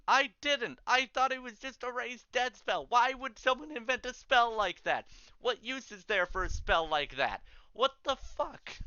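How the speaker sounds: a terrified student who just learned what he unleashed; panicked denial. Acted.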